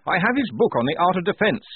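high, loud officer's voice; Blouse. A narrator's reading. Lieutenant identifying a dead general, high keen voice. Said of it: "said Blouse".

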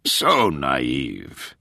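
low, weary groan of contempt; exasperated, low-pitched condescension; groaning disdain. A narrator's reading. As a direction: groan it out in a dropped voice. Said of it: "Sexton groaned".

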